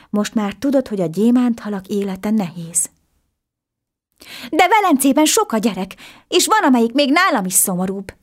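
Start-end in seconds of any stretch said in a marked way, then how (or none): 4.5-8.1 put-on high, raised boy's cry. A narrator's reading. Boy crying out to the fish about Venice's sad children, raised and urgent. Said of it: "kiáltott fel a kisfiú".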